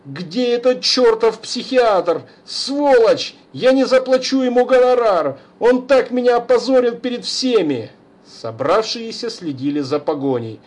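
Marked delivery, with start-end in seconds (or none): 0.0-7.9 high, loud angry outburst; exasperated shouting. Acted. Father chases son, exasperated angry shouting. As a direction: rage at the son and the psychiatrist.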